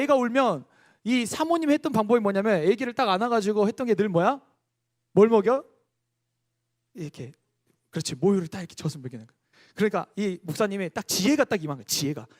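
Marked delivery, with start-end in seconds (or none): none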